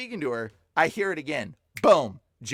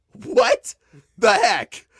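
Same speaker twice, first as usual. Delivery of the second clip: high, loud "what the heck"; re-enacted shock, pitch leaping on "what"; retold shock; 10 semitones higher, 8 dB louder, much slower than usual. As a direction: blurt it out in stunned disbelief. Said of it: "standing in shock".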